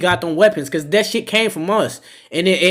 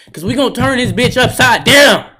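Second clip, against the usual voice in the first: louder, higher angry vow; 4 semitones higher, 6 dB louder than usual. Real, not acted. Streamer raging to chat about the fight, loud angry vow. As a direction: promise chaos with fire.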